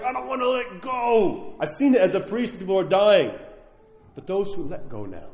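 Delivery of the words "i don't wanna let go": high, drawn-out mock plea; cling on, stretching the words in a higher voice; desperate clinging. Voicing someone attached who is dying, desperate clinging.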